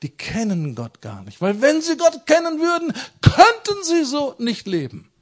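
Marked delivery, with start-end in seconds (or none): 1.4-5.1 high, loud preaching peak; fervent preacher's climax; passionate high cry. Acted. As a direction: throw it out high to the whole church.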